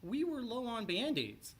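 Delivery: ordinary delivery, nothing marked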